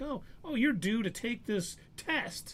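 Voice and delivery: high, slow clerk voice; lightly acted official breaking news; imitated clerk. Voices clerk announcing a test.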